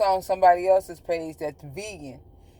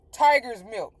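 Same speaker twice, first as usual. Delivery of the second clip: ordinary talk, nothing marked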